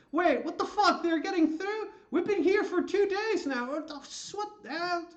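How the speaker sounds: high, loud indignant merchant yelling; outraged merchants. Acted. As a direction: shriek at the guards for cutting the line.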